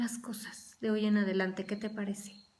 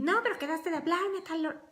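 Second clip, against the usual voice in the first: higher, whiny reproach; mock complaining partner; 8 semitones higher than usual. Preacher imitates a partner complaining about a missed call, higher whine. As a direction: whine like a let-down spouse.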